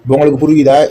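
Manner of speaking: ordinary delivery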